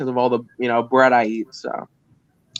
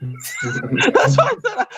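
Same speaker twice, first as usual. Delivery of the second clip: laughing; much slower than usual. Real, not acted. Laughing at the host.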